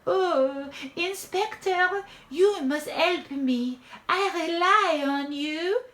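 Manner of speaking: put-on high, slow, imploring French accent; theatrical damsel in distress; pleading French diva. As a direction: flatter and implore, drawing out each phrase. Diva flatters the smitten inspector.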